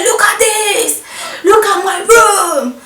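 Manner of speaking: high, loud, slow distressed shout; upset matron. Retold or imitated. Matron showing the student her ruined room, slow high shout. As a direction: cry out over the ruined room. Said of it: "She was like".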